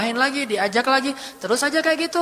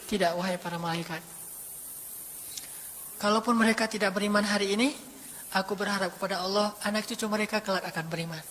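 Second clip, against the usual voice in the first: gentle refusal; 2 semitones lower, 8 dB quieter than usual. Acted. Prophet gently refuses the angel.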